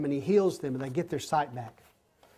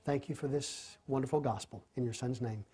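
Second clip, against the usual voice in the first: soft, low prayer closing the study; 3 semitones lower, 3 dB quieter than usual. Acted. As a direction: pray quietly and earnestly over the group.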